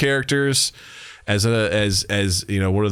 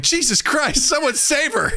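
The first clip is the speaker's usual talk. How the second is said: high, alarmed cry for help; mock panic. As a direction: squeal it up high as if she's really falling. Crew panicking over Streep tripping.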